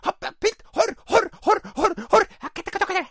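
very high squeaky honking cries; clown honks. Pretend. Shot clown entertaining the paramedics, high squeaky honks. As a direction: honk on like a wounded clown. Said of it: "Era fiel a su oficio de payaso".

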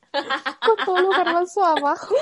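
laughing through the words; giggling while picturing it. Real, not acted. Picturing mom over hidden chickpeas.

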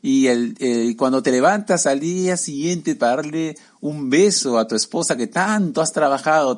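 usual speaking voice

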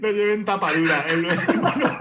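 high laughing voice. Real, not acted. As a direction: giggle through it. Laughs at his bound script. (riéndose a carcajadas)

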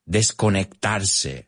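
ordinary talk

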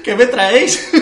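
high, loud mock puzzlement through laughter; laughing mock. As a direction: laugh while voicing the confused friends. Imitating puzzled teammates, laughing. (imitación entre risas)

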